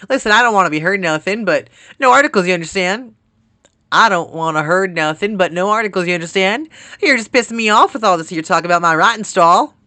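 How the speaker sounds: put-on loud, high, riled-up country accent; hammed-up country grumbling. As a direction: play an annoyed country character with relish. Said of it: "every time I do a country accent".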